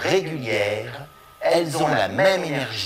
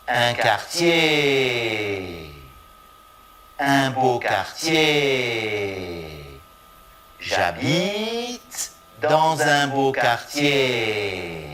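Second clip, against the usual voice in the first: very slow, growing phrase, long final syllable; slow build-up; much slower than usual. Shown as a demonstration. Teacher builds the phrase slowly for repetition. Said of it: "Un groupe est prononcé comme un seul mot".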